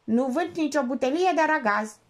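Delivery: a lightly voiced parrot, cheerful; happy parrot. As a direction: repeat it like a pleased parrot. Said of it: "Cheops repeta într-una, fericit".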